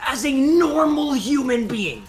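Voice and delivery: worked-up rant. Real, not acted. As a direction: rant about the absurd stunt. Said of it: "Your voice sounds kinda different".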